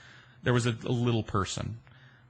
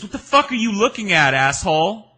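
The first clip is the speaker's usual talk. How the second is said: loud, higher retold shout; hostile yell; 10 semitones higher, 8 dB louder than usual. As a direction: snap it at him, higher and louder. She yells at him again.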